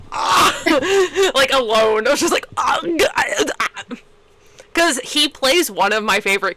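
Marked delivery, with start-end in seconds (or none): 0.1-1.3 high stifled squeal; reenacted high-pitched "ah" of disbelief; retold fangirl squeal. Reenacts freak-out in bathroom stall. 2.6-3.8 repeated high squeals; reenacted high-pitched freak-out noises; retold fangirl squeal. Reenacts freak-out in bathroom stall.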